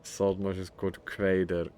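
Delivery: normal talking voice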